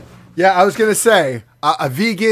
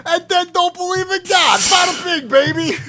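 higher-pitched, hammed-up Jersey boasting; mock New Jersey accent; 4 semitones higher than usual. Continues the Jersey character's boasting.

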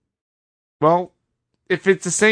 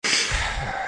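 long unvoiced sigh of dread; weary sigh; 7 dB quieter than usual. Real, not acted. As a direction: let out a tired, resigned breath. Dreading terrible takes.

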